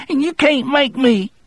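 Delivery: put-on high-pitched petulant child voice; childish trembling voice. A narrator's reading. Percy on the verge of tears refusing, childish voice. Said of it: "in a childish, trembling voice".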